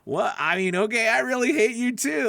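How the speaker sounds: high, puzzled "I really hate you too"; a confused listener returning the words; mock confused reply. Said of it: "Because you're like".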